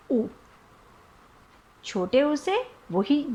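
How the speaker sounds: usual speaking voice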